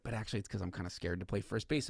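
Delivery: a hint of a sheepish player; mock confession. Devers quietly admitting fear, mock sheepish. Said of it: "And then he's like".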